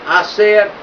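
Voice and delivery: ordinary delivery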